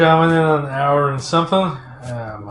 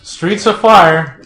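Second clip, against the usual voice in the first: excited; 4 semitones higher, 9 dB louder, slower than usual. Real, not acted. Excited recalling favorite film.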